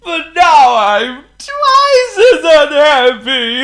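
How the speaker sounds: loud, high, drawn-out misery; hammed-up tearful wail; wailing king. A narrator's reading. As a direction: bawl it slowly like a miserable king. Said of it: "he wailed".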